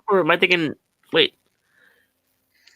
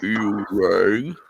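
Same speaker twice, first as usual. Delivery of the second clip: much lower, slow Lurch line; 10 semitones lower than usual. Acted. For friends, deep Lurch voice. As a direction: drop to a deep butler voice. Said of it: "I can't really go deep".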